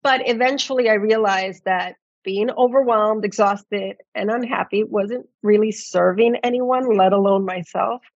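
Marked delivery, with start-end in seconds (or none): none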